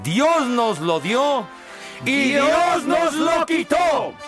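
hammed-up grief, drawn out; mock dramatic lament. Mock mourning shout.

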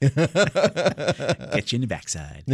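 drawn-out, savoring each word; slow amused echo. Pretend. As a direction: repeat it back slowly, enjoying it. Echoes colleague's phrase slowly.

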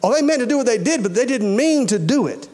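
ordinary talk, nothing marked

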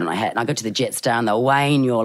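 ordinary talk

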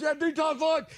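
high retold call-back; high-pitched confirmation sent through the house; calling from another room. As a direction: imitate her calling back from the bedroom. She comes out, she goes.

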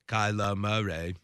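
low, soft Southern impression; Forrest Gump. Host saying names for co-host, low Southern impression. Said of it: "You sounded like Forrest Gump".

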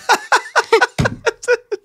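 big high fake laugh; hammy salesman cackle. Shown as a demonstration. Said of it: "you have to be like".

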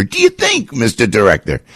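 high mocking jab at the director; sarcastic question. Real, not acted. Host taunting the FBI director, high and sarcastic. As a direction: ask it with a sneer.

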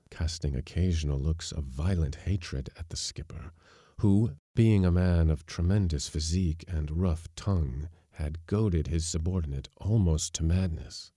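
usual speaking voice

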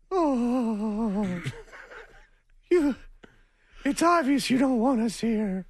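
high, drawn-out mock wail; imitating wounded mom. Mom collapses under son's yelling, hammed-up wounded wail. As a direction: play the mother felled by words. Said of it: "She just falls down".

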